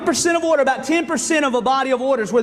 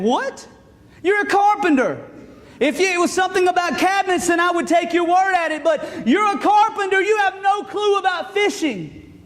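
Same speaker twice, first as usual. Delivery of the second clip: higher-pitched, disbelieving protest; incredulous retort; 7 semitones higher than usual. Acted. Peter voiced as incredulous at Jesus.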